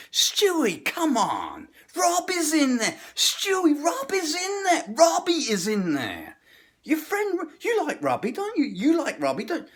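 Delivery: guilt-trip him into the restaurant; Joe guilting. Retold or imitated. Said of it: "this is how Joe guilts me".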